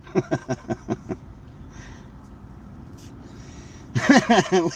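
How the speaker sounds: long airless, breathy laugh; silent wheezing laugh. Real, not acted. Denying blame to Cheyenne, long silent wheezing laugh. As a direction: shake with a laugh that makes no voice. Jokes he didn't cause the wreck.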